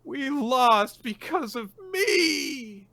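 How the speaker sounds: high, slow, sobbing wail; acted sobbing wail; crying out. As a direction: break down and wail the blame. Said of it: "she starts full on crying".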